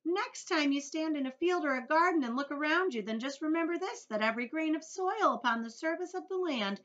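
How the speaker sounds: high, loud, insistent creature voice; Earthworm. A narrator's reading. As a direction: proclaim its importance proudly. Plays earthworm boasting.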